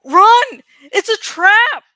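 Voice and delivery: urgent, loud cry of alarm; shouted warning. Acted. Character warns the others of a trap, urgent shout. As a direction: yell a sudden warning at full tilt.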